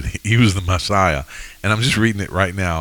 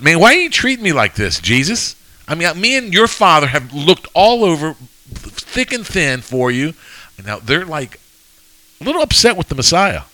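mock upset mother; 8 semitones higher than usual. Voicing Mary scolding Jesus.

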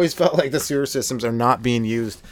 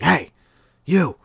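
higher, louder street holler; calling out; 8 semitones higher, 5 dB louder than usual. Retold or imitated. Street man calls to two passing friends, calling out. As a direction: hail a passerby, two separate shouts.

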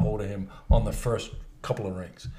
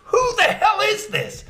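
high, drawn-out outraged squawk; slow, high-pitched comic demand; Larry David; slower than usual. Retold or imitated. Accountant demanding who's calling, high Larry David impression. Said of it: "just like in Larry David's voice".

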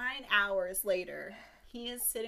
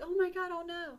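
high-pitched, louder dismayed cry; mock panic; 9 semitones higher, 5 dB louder than usual. Pinocchio sees the kid he hurt bleeding, in high mock panic. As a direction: act out a puppet's horrified reaction.

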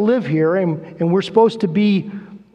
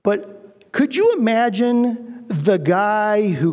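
ordinary delivery

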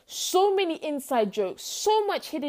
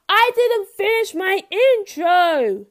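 dramatic announcer; 8 semitones higher, 10 dB louder, much slower than usual. Acted. Mock dramatic intro aside.

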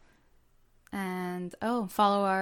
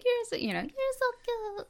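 much higher mock Colombian accent, a bit soft; a light, high Colombian accent gushing over a girl; imitating Sofia Vergara; 3 dB quieter, much faster than usual. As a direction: squeak out a Colombian accent, sweet to a child. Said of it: "like, however her accent is".

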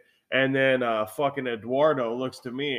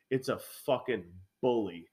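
usual speaking voice

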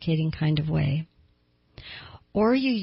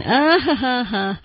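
mock laugh, higher and put-on; Rich's courtesy laugh; 6 semitones higher, 4 dB louder, slower than usual. Widow imitating her husband on the phone, fake courtesy laugh. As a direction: force out a fake "ha ha ha". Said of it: "fake laugh".

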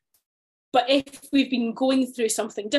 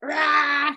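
a short loud frustrated roar, louder and higher; venting roar; 5 semitones higher, 4 dB louder than usual. Retold or imitated. Venting to a friend or colleague, a loud frustrated roar. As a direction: let out a growl of pent-up frustration. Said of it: "friends or colleagues that you can just go".

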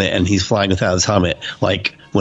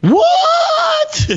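very high, loud stunned yell; shocked shout; 4 dB louder than usual. Retold or imitated. Reacting to Joes dying in the comic, shocked high yell. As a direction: blurt it out in total disbelief.

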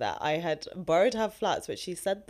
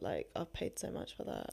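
whispered, much lower; whispered aside; 12 semitones lower, 13 dB quieter than usual. Real, not acted. Aside about pedicure cost.

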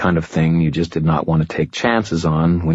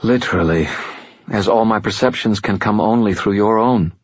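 higher, silky voice of an ancient fallen angel, calm and superior; fallen angel Lashiel; 4 semitones higher than usual. Acted. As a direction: speak as a patient otherworldly being, a little above it all. Lashiel speaks.

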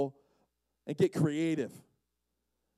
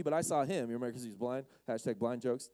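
ordinary talk

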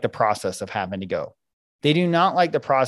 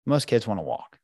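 ordinary delivery

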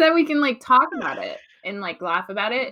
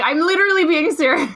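loud, high exasperated imitation; hammed-up insistence on being serious; mock-serious protest; 6 semitones higher, 7 dB louder than usual. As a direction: protest loudly, breaking into a laugh. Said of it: "Nick's trying to be serious".

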